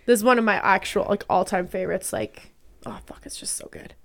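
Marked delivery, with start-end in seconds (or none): 2.1-3.9 breathless hushed whisper of delight; soft whispered swoon; awed whisper. Real, not acted. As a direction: whisper it, lost in the song. Whispering over the song.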